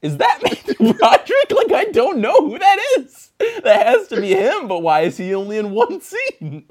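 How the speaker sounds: high, loud, laughing double take; incredulous laughing rant; laughing disbelief. Retold or imitated. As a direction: squeal it through laughter in confusion. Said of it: "You'd be like".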